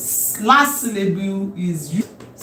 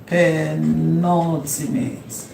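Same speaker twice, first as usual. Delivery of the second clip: very slow, drawn-out term, lower pitch; slow dictation; 4 semitones lower, 3 dB quieter, much slower than usual. Shown as a demonstration. Teacher repeating a new term to students, slowly and drawn out. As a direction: stretch out each syllable of "Penultimate".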